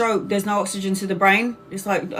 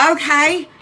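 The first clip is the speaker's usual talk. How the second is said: high sarcastic okay; 7 semitones higher, 4 dB louder than usual. Retold or imitated. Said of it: "I'm like".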